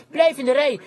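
high, loud urgent cry; retold shout. Preacher reliving his shout to the marching girls, urgent shout. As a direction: call out forcefully to marchers breaking rank. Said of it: "En ik schreeuwde".

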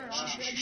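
soft hissed shushing; soothing hush. Retold or imitated. Pastor patting a grumbler on the back, soothing hush. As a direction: hush them quietly.

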